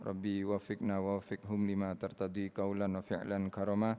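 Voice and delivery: soft, quick Arabic prayer recitation; closing prayer. Acted. (doa penutup dibaca perlahan)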